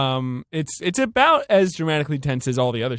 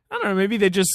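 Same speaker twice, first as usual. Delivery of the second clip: usual speaking voice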